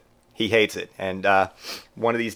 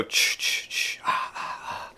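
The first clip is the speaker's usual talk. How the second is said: whispered rhythmic "ch" puffs fading into breathy "ah"s; unvoiced hissing, then soft exhaled echoes; imitated slasher sound; 9 dB quieter, slower than usual. Teasing Aunt Lori with the Jason sound, whispered imitation. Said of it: "You go".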